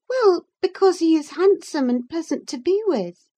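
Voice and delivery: put-on high, insistent young lady; high-pitched defensive answer; Catherine. A narrator's reading.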